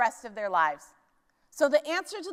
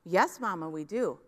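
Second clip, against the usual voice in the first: put-on small children answering; 7 semitones lower than usual. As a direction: lightly voice the dutiful little kids. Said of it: "children answer mama".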